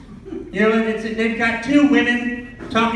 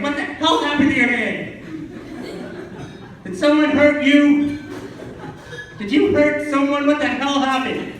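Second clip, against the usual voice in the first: yelling at the radio; 5 semitones higher than usual. Retold or imitated. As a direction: shout your disbelief at a commercial. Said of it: "I'm yelling at the radio".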